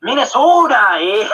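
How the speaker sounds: mock Minnesota accent, drawn-out vowels; Minnesotan accent. Streamer teasing friends with a mock Minnesotan accent. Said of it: "they kinda do talk like this".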